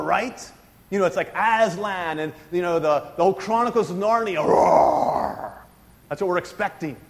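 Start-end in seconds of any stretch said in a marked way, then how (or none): 4.4-5.5 breathy unvoiced roar; imitated lion roar. Preacher imitating the roar of Aslan the lion. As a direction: let out a lion's roar. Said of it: "you just expect to hear this lion roar".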